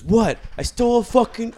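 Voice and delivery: loud, high, indignant outburst; outraged son. Acted. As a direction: blurt it like a spoiled son caught out. Son shouts back, movie quote.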